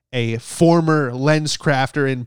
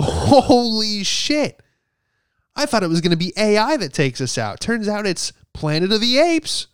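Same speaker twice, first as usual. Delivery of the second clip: stunned disbelief; 8 semitones higher, 3 dB louder than usual. Retold or imitated. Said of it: "you'd go".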